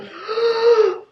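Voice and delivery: high, loud gasp; shocked gasp. Real, not acted. Reader shocked by a professor in the book, sharp gasp. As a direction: suck in air in shock.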